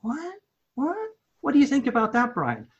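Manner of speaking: high incredulous what, what; mock disbelief. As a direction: act floored by the idea. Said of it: "And I'm like".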